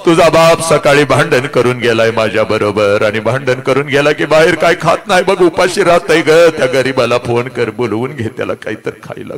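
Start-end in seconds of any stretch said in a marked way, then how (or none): none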